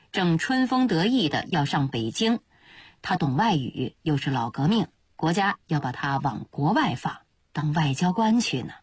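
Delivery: ordinary delivery, nothing marked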